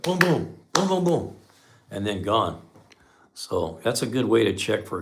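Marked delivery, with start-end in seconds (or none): 0.0-1.4 sharp, high booming imitated misfires; engine misfire noise. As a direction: bang out the miss like an engine. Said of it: "ignition miss will be like".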